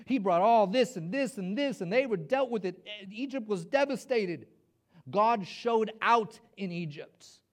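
loud, punchy listing; forceful preaching. Acted. Preacher recalling God's miracles in Egypt, emphatic and loud.

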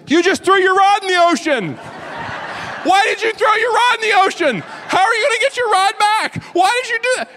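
hammed-up incredulous little boy. Kid on the dock scolding him, high squeaky kid voice. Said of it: "he goes".

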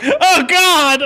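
high yelp of pain and shock; panicked cry. Retold or imitated. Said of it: "he's like".

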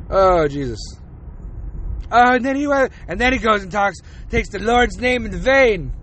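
normal talking voice

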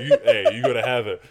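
loud laughing insistence. Retold or imitated. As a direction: push it through laughter. Friend insisting, retold laughing.

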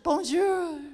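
loud, hammed-up Franco-Italian accent; mock foreign accent. Traveller evangelising the young woman, loud mock foreign accent. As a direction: bellow broken foreign English with dragged endings. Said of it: "I said".

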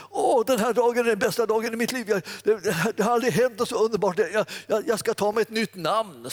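high, loud, rushing with joy; overjoyed. Retold or imitated. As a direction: burst out like the happiest day of your life. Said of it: "han blev så glad". (överlycklig, hög och ivrig röst)

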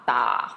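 low, dipping "da"; third tone. Shown as a demonstration. Teacher running through four tones on one sound, "da" low and dipping. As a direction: sink "da" right down low. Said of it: "One more time".

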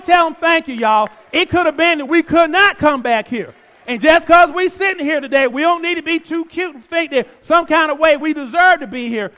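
loud, high, fervent preaching; preaching at full force. Acted. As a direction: preach it hard to the congregation.

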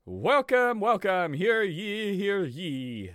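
high, booming medieval town crier. Pretend. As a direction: proclaim it like a town crier in the square.

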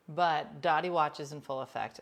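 usual speaking voice

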